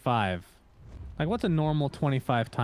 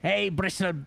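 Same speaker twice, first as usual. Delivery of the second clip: higher-pitched attempt at Cockney; Cockney accent; 7 semitones higher, slower than usual. Caster imitating Bristleback for his co-caster, attempted Cockney accent. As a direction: have a go at a Cockney character voice. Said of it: "It's like a Cockney accent".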